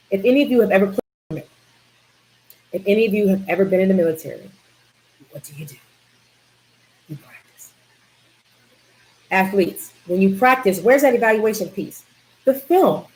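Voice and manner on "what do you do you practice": whispered answer. Real, not acted. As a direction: drop to a whisper. Answering her own question.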